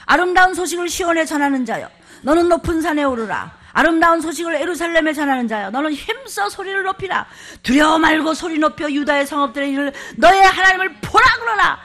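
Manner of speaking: loud, high proclamation of scripture; shouted scripture. Acted. Pastor shouting Isaiah to the congregation, full force. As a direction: cry it out like a herald.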